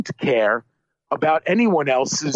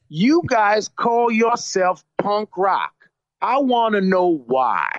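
slow, higher mock-jerk voice; jerk-off accent; 6 semitones higher, slower than usual. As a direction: stretch the words like a heckler demanding answers. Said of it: "to impersonate a jerk off".

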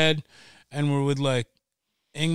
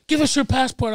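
mock cop demand; 10 semitones higher, 4 dB louder than usual. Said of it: "they're like".

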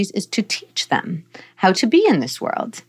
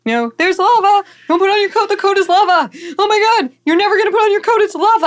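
high, loud, pretend panic for a toddler; playful mock alarm; 13 semitones higher, 4 dB louder than usual. Shown as a demonstration. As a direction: ham up the alarm so the kid laughs. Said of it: "And so if we can say".

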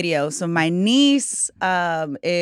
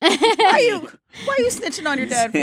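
scolding adult; 11 semitones higher, 4 dB louder than usual. Retold or imitated. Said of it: "They'd be like".

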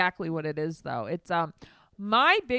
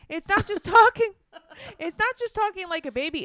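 squeaky mock voice; 12 semitones higher, 4 dB louder than usual. Mocking the baby voice.

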